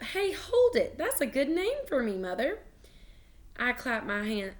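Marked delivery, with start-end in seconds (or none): 0.0-2.6 put-on high-pitched child struck by an idea; light up with a bright idea; sudden delight. A narrator's reading. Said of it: "my whole face lighted up".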